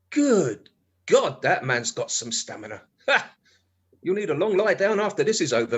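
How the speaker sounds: usual speaking voice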